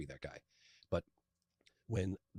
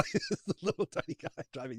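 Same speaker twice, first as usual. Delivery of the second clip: laughing through it, slow and high; real laugh, words stretched out; 10 semitones higher, slower than usual. Real, not acted. Laughing over the tiny driver.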